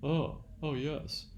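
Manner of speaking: mock eager voice, slowing and softening on "oh yes"; ironic imitation. Lecturer mocking a reluctant student to show irony, ironic mock eagerness. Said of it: "I'm sort of imitating someone".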